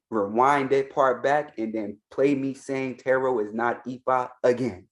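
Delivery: normal talking voice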